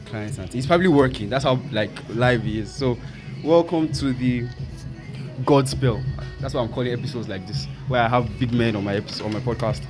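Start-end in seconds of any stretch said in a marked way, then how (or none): 3.4-6.0 announcer voice. Acted. Podcast host welcomes the guest.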